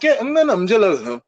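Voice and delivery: high mumbled mom phone chatter. Retold or imitated. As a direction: imitate mom gabbing on the phone.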